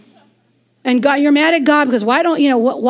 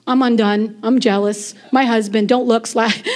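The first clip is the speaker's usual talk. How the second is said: mock jealous sulk; 5 semitones lower than usual. Preacher mocks women jealous of another's looks, low sulky mumble. Mimics a sulking jealous woman.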